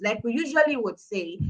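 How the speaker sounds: ordinary delivery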